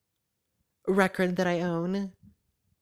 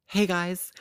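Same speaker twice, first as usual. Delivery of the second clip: ordinary talk, nothing marked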